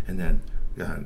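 usual speaking voice

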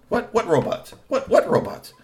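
he truly has no idea; baffled uncle. A narrator's reading. Kamakura to Kusumono, baffled. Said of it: "Kamakura said".

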